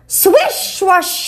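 ordinary delivery

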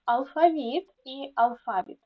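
АЛФАВІТ said twice, stress on the last syllable, then on another; double stress. Shown as a demonstration. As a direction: show both accepted stresses of АЛФАВІТ in turn. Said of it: "слова з подвійним наголосом".

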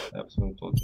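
whispering; whispering while hiding. Real, not acted. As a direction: whisper it, trying not to be heard. Hiding, listening for enemy.

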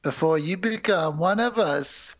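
very slow, ominous mock warning; theatrical Goblin King. Goblin King threatens Sarah's brother, hammed-up slow drawl. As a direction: stretch every word towards a grand ending.

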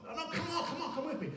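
high, wheedling and laughing; coaxing tempter. Retold or imitated. Eve pressing her husband to come along, high wheedling giggle. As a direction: lure him along with a giggle.

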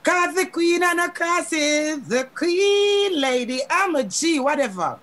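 slow, drawn-out queenly boast; lightly sung-out brag; boastful swagger. Acted. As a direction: stretch it out like a diva. Boasting song persona, drawn out.

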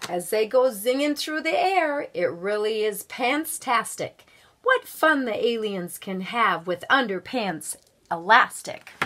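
put-on lively read-aloud voice for children; storybook reading. A narrator's reading. Guest reader reading a picture book to schoolchildren, lively storybook voice.

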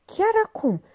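"chiar" jumps high, dazed and scattered; wife repeating husband, flustered and dazed; flustered echo. A narrator's reading. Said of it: "făcu zăpăcită".

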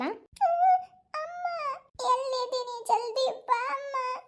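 high, drawn-out sobbing call for mother; a lost little girl wailing for her mum; crying girl. Acted. Lost girl in the forest calling her mother, crying. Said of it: "ಯಾವ್ದೋ ಹುಡುಗಿ ಅಳಕತ್ತಿದ್ sound".

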